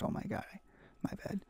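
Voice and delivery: ordinary talk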